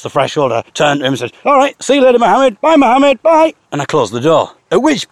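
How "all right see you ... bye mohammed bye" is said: high, loud overeager farewell; much higher, louder bye-bye; gushing goodbye. Retold or imitated. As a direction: pile on the goodbyes. Overdone goodbye at door.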